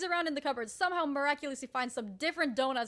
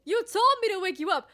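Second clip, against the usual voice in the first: high, loud indignant protest; exasperated retort; 7 semitones higher, 8 dB louder than usual. Retold or imitated. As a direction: throw it back at him, exasperated. Retold quarrel with sleeping brother.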